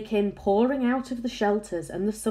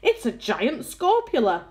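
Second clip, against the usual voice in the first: loud, higher-pitched despairing cry; alarmed yell; 5 semitones higher, 5 dB louder than usual. A narrator's reading. Fire chief faced with a giant spider, alarmed yell. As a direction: announce doom in a raised, alarmed voice. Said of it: "yelled the head of the fire department".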